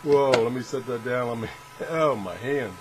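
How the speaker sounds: ordinary delivery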